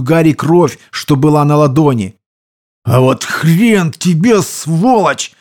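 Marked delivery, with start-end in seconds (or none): 2.8-5.3 slow, higher-pitched angry defiance; spiteful, forced out. A narrator's reading. Wounded fighter curses the enemy soldier, spitefully. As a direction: squeeze the curse out slowly, with spite. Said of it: "зло выдавил он из себя".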